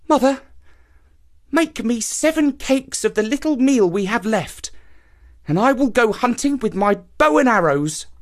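a hungry son asking brightly; young man. A narrator's reading. Hungry son asks his mother for cakes, high and eager.

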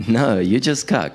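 ordinary talk, nothing marked

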